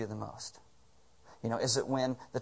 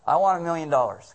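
mock honest wish; 4 semitones higher, 8 dB louder than usual. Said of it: "And it'd be like".

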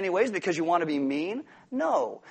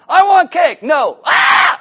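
put-on screaming toddler, much higher and much louder; furious toddler tantrum; 11 semitones higher, 15 dB louder, much slower than usual. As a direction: throw a full toddler tantrum for cake. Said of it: "They got two gears, happy and furious".

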